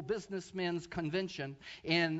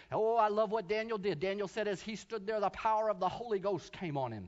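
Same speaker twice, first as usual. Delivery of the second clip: normal talking voice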